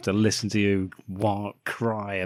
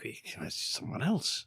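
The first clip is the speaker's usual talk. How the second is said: whisper; 9 dB quieter, slower than usual. Retold or imitated. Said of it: "the first instance that we think".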